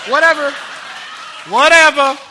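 loud, high brush-off; loud dismissive whatever. Retold or imitated. Preacher retelling how he brushed off his wife, loud dismissal. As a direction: shrug it off at full volume. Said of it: "I was like".

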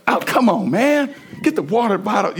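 high, fed-up self-scolding; exasperated. Retold or imitated. Preacher mimics himself knocking over a bottle, exasperated. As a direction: groan at yourself for knocking it down again. Fumbling the same thing twice.

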